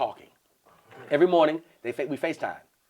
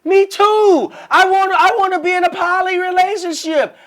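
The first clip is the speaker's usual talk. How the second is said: very high, loud, excited imitation; thrilled outburst; 9 dB louder, slower than usual. Woman delighted by his poly confession, squealing excitement.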